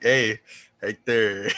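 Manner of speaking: slow casual greeting. Retold or imitated. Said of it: "he's like".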